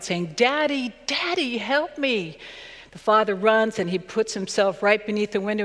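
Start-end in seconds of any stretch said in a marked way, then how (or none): 0.3-2.4 put-on high pleading cry of a trapped child; child crying for help. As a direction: call out like a scared boy at the window. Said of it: "he's crying out".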